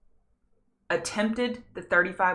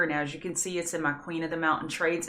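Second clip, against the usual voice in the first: ordinary delivery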